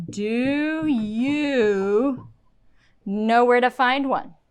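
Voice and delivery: "Do you" drawn out slowly, then a pause; grammatically full question. Shown as a demonstration. Teacher adds the missing words, emphatic "Do you". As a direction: stretch "Do you", then fall on "one". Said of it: "I wanted to be grammatically correct".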